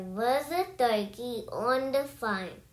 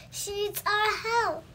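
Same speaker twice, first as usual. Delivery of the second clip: mock shout, pitched way up; rooster calling out; 9 semitones higher than usual. A narrator's reading. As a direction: call for help as a high-voiced rooster. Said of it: "shouted the rooster".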